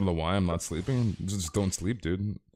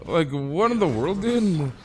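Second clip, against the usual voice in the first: disbelief; 7 semitones higher, 4 dB louder, slower than usual. Real, not acted. Reacting to the story.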